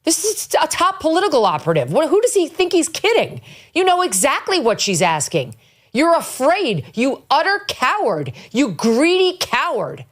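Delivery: high, indignant tirade at a coward; scornful outburst at the witness; outraged. Real, not acted. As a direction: let the disgust rise, pitch climbing.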